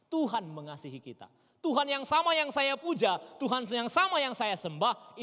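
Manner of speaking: loud, high, rising fervour; fervent preaching. Acted. Pastor fervently tells the congregation God is the same for them. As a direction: build it like a sermon peak.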